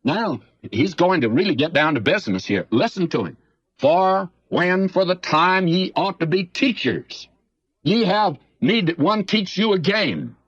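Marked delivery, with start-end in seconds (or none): 3.8-10.4 slow, higher solemn Bible reading; scripture reading. Acted. Preacher reading Hebrews to the congregation, slow and solemn. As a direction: read the verse slowly and solemnly.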